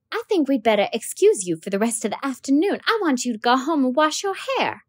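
teacher asking a dirty pupil to spell his name; flustered teacher. Acted. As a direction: coax the boy politely, a little flustered.

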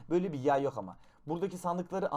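normal talking voice

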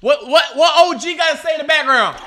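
loud, high gloating; triumphant taunt. Real, not acted. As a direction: crow at him, building to a shout. Taunting the silenced heckler.

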